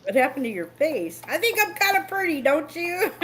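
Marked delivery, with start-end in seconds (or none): 1.3-3.1 vain mock voice. Joking vain put-on voice.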